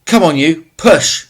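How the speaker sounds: loud, high straining command; urgent shout. Acted. Tesfaye shouting at Mamo while heaving the cow, urgent. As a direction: bark at him to push harder. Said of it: "Tesfaye was shouting".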